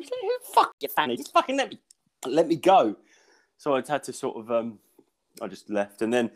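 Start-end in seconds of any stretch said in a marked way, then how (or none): 0.0-3.0 high-pitched mock outrage; exasperated outburst. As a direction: act out a squeaky-high fit of impatience. Acted exasperated inner outburst at tester.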